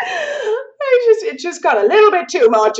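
overwhelmed. Retold or imitated. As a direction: let it burst out, overwhelmed. Overwhelmed by old conditioning.

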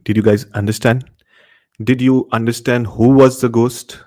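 ordinary delivery

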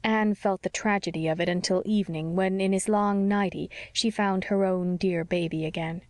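plain reading voice

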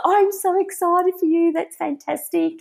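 high, gushing imitation of the boss; delighted for her, pitched well up; lovely and excited. Boss congratulates resigning employee on army news, excited. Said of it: "she was so lovely".

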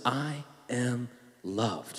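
very soft and slow, each word drawn out; hushed, drawn-out I am loved; slow soft affirmation. Shown as a demonstration. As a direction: model it gently for them to repeat. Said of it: "You say it with me like this".

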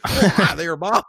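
high, bright mock newscaster greeting. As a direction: parody a cheesy TV reporter.

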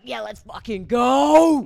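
slow, loud party shout; hyped shout. Retold or imitated. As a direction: yell it like a party hype. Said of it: "I was like".

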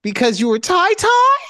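mock shock at the silly excuse; playful incredulous rise; incredulous tease. Real, not acted. Teasing disbelief at her excuse.

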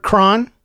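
crayon shrunk to "cron", rhyming with on; one short syllable, "cron"; variant mispronunciation. Retold or imitated. Quoting a variant heard for crayon, one-syllable mispronunciation. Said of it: "as a variant on that".

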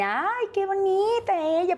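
high, slow, sugary imitation of the doctor; sweet doctor voice. Imitating the doctor cooing over her patient, high and slow. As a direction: coo it like a patronizing doctor.

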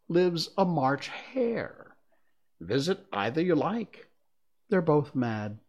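slow, teasing cat voice; amused cat, taking its time; snooty bored cat. Acted. The Cheshire Cat voiced in story.

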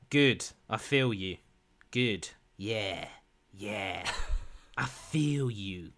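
slow, drawn-out I feel you; mock sultry repeat. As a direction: stretch it out, purring. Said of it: "she goes".

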